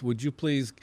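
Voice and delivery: normal talking voice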